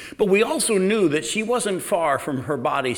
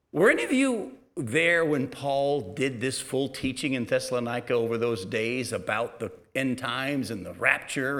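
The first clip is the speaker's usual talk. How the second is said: normal talking voice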